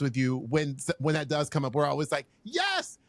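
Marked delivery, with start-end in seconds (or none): 2.4-3.0 high-pitched whoop of support; excited cheer. Retold or imitated. Friends reacting whenever his name comes up, excited cheer.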